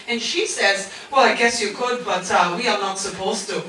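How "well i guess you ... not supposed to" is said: low, soft mock Dutch accent; bad Dutch accent. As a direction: imitate a Dutch woman with a German-sounding accent. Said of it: "I know my Dutch accent sounds German".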